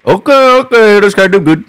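loud, high hype shout; excited shout. Real, not acted. Beatbox battle hype.

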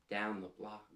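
usual speaking voice

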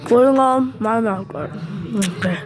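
slightly muffled consonants; mouth guard in. Real, not acted. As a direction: lips around guard. Seen 1: Blue guard seen over teeth; fitted in mouth before speaking.

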